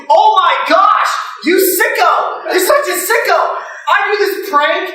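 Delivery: high, excited teasing; hammed-up high-pitched mockery; gleeful prankster chef. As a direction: play the chef crowing over his prank. Chef gloats after revealing prank.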